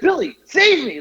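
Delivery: high-pitched, comic begging; mock plea for help. Storyteller begging wrestling dad for rescue, mock desperate. Pleading with friend in retold scene.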